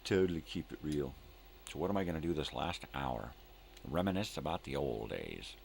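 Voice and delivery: ordinary talk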